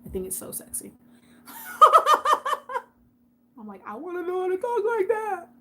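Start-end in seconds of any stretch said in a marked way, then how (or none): 1.5-2.8 loud, high-pitched burst of laughter. Real, not acted. Tickled by how UK people talk, high laugh. 3.9-5.5 high, put-on squealy voice; playful envy. Admirer of UK speech to viewers, high playful envy. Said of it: "I wish I could talk like that".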